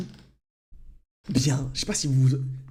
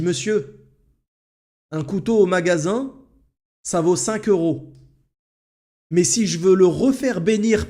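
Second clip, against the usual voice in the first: curt lady; 5 semitones higher, 5 dB louder than usual. Retold or imitated. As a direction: lay out the prices coldly to the customer. The lady explains, annoyed.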